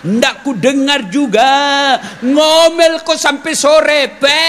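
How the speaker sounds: slow, loud, mocking drawl; slow taunting. Husband taunting his nagging wife down the well, slow gloating. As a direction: gloat over her, word by word.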